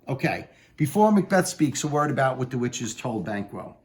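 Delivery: ordinary talk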